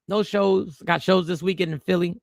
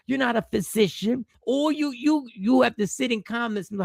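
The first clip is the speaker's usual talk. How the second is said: pressing point; 7 semitones higher than usual. Real, not acted. Insisting to audience, rising.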